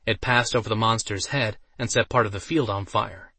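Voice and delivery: usual speaking voice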